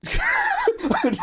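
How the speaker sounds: squealing laugh of delight; high delighted squeal-laugh. Real, not acted. Streamer reacting to a fan animation, high squealing laugh. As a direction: burst into a high excited laugh. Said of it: "I'm just making exclamations".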